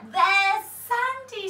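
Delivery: disgusted. A narrator's reading. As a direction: spit out the gritty cakes. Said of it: "said Percy Patch".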